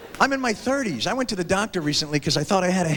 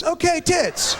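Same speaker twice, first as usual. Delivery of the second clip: host yell; 10 semitones higher, much slower than usual. Retold or imitated. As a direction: call it out like a game show. Host shouting category.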